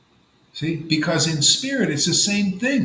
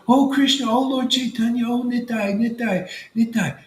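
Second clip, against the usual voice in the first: imitated devotional calling; 7 semitones higher than usual. A devotee sitting there calling on Krishna, high-pitched invocation. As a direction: call out to the Lord like a devotee. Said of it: "you're sitting there".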